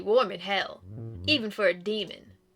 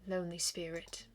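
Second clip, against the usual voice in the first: soft ghostly whisper, much softer; hushed ghostly farewell; 5 semitones lower, 15 dB quieter than usual. Acted. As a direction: let it fade out like a spirit. Ghost's parting words.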